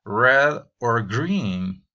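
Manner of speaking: drawn-out slow question with choices; slow model reading. Shown as a demonstration. Teacher slowly reading an example question with choices. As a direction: read it slowly, stressing each option. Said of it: "agrego a la pregunta".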